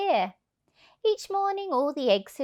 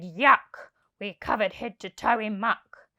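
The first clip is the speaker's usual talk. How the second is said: disgusted thief. Acted. Hugh covered in muck, disgusted. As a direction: spit out the disgust. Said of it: "Hugh said".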